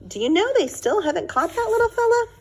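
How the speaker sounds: high, playful dad joking with his daughter; teasing father. Acted. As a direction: lift it up like a tease.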